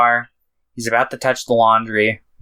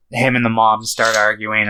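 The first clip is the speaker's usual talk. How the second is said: ordinary delivery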